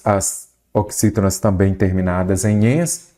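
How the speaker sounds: ordinary delivery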